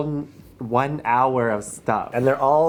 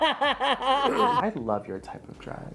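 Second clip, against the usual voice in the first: laughing mock quote; 8 semitones higher, 6 dB quieter, slower than usual.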